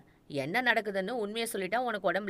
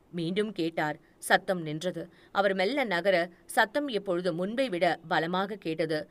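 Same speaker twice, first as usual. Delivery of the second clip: ordinary delivery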